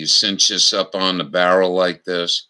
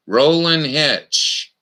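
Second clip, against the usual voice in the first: slow emphatic; 6 semitones higher, much slower than usual. Shown as a demonstration. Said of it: "final naming of knot".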